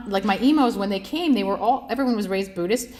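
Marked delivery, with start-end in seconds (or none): none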